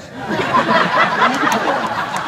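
squealing high-pitched laugh. Real, not acted. Laughing at Leia idea.

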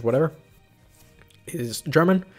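ordinary delivery

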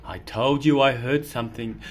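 usual speaking voice

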